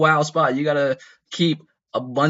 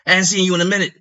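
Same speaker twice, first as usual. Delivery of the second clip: a touch higher, louder rough greeting; rowdy older guy; 6 semitones higher, 4 dB louder, faster than usual. Retold or imitated. The OG greets him from behind, rowdy and a bit loud. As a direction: hail the kid like an old-timer, a bit loud. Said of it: "I'm not doing a thrash impression".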